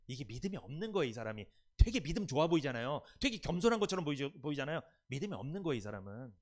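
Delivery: usual speaking voice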